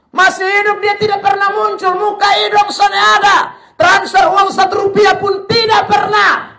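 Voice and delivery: high, loud, slowing scold; preaching shout. Acted. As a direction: shout the accusation at them. Preacher scolds neglectful children. (teriakan marah dalam khotbah)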